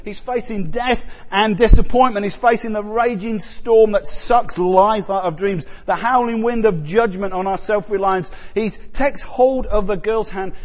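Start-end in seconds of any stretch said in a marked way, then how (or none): none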